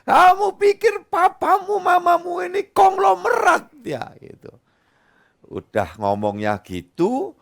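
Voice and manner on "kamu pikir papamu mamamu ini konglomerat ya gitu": high, loud mocking parent voice; sarcastic scolding parent. Parent mocks child who asks for expensive things, high and sarcastic. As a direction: scoff at the kid's demands.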